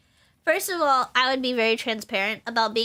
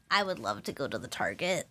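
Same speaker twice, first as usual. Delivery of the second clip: low amused reply; 10 semitones lower, 8 dB quieter than usual. Retold or imitated. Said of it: "And I'm like".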